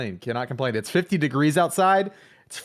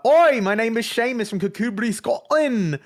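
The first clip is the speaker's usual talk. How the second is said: Scottish character voice; 3 semitones higher than usual. Acted. Co-host tries an Irish voice for Saint Patty's, comes out lightly mock Scottish. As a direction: play Seamus with a hint of a Scottish accent. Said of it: "got into character for that".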